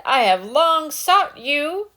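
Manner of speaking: the hunter has found his prey; stern hunter. Acted. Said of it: "he said".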